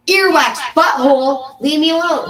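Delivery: high, loud insult; mock yelled retort. Trading insults with a rival, mock yelled retort. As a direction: yell it back in a playground-style quarrel.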